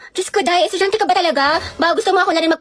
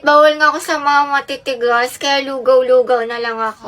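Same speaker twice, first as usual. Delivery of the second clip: talking through sore braces; 3 semitones lower, slower than usual. Acted.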